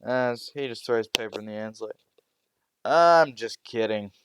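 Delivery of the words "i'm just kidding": draws it out loudly, teasing the class; loud, slow joking teacher. Acted. Teacher teasing the class with a slow, loud joke. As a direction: announce it slowly and loudly to the room.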